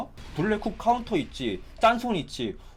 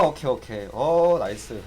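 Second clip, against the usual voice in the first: usual speaking voice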